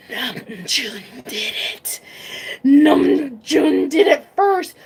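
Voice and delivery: slow, unvoiced hush building to a voiced exclamation; breathless fan excitement. Real, not acted. Fan reliving the concert moment, breathless whisper. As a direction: whisper it, then burst out.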